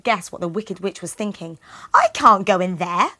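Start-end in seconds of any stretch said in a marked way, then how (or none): 1.9-3.1 Gretel. Acted. Gretel protesting.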